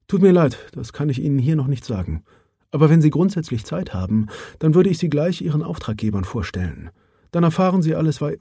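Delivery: lightly voiced businesslike woman; Mara Johansson. Mara evades questions. (sachliche Frauenstimme, leicht angehoben)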